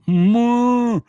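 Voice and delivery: long, loud, high moo; moo cow. Retold or imitated. Telling of odd elk, loud cow-like moo. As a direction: imitate an elk mooing like a cow. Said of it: "sound like a moo cow".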